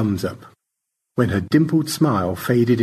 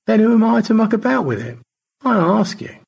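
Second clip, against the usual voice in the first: put-on fast, much higher airy old voice; George; 13 semitones higher, 4 dB louder than usual. George blesses the romance, high airy voice. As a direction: toss it off grandly.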